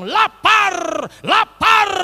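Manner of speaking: high, loud cry from the heart; suara hati berteriak. Retold or imitated. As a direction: let each word burst out high and forceful. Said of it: "langsung hati bilang". (seruan hati yang nyaring dan tinggi)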